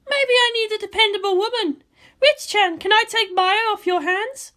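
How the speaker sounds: a tense commander on the eve of battle; Misato's voice. Acted. Misato brooding to Ritsuko about tomorrow, high and loud.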